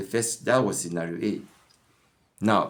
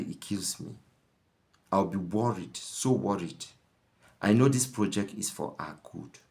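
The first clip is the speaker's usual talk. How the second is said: tender wife; 2 semitones lower, 4 dB quieter than usual. Retold or imitated. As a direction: sweet-talk him gently. Wife sweet-talks husband.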